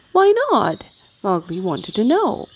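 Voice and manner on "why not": high, bright-voiced eager question; eager boy. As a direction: ask like a curious boy, much higher. Mowgli eagerly questions panther.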